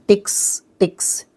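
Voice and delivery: "टिक्स" said alone as the word's ending; ending sound demonstrated. Shown as a demonstration. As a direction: isolate the final "टिक्स" sound, repeated. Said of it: "वो वाली sound आ रही है पीछे".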